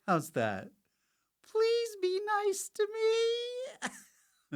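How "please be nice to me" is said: high squeaky begging; hammed-up tiny whine; mock pleading. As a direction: plead in a silly falsetto. Mock pleading after the rant.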